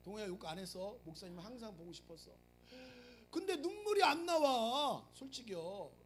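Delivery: ordinary talk, nothing marked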